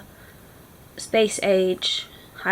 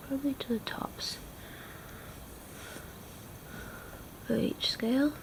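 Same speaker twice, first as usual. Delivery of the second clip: whisper; 3 semitones higher, 10 dB quieter than usual. Real, not acted. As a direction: breathe it out softly while drawing. Whispered drawing commentary.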